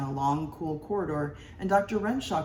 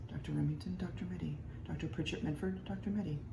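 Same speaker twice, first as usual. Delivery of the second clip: much softer hushed intros in an operating room; whispered introductions; 10 dB quieter, faster than usual. A narrator's reading. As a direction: murmur names quietly beside the patient. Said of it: "whispered introductions".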